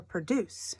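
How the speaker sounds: pruh-DUCE; stress on second syllable. Shown as a demonstration. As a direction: say produce with weight on DUCE. Teacher contrasts the two stresses.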